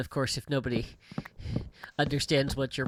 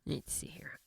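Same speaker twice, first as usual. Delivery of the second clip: breathy whispered musing; whispered let's see to himself; 3 semitones higher, 12 dB quieter than usual. Real, not acted. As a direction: murmur it under your breath. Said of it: "same words retaken, whispered".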